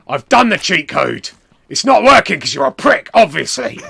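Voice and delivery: loud, rising angry protest; acted indignant shouting; irate wrestler. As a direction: shout it back, exasperated. Wrestler quarrels over cheat code.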